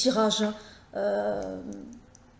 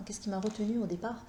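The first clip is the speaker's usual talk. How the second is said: much softer, thinking aloud; musing to herself; 9 dB quieter than usual. Real, not acted. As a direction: repeat the question quietly to yourself. Repeats the question to herself.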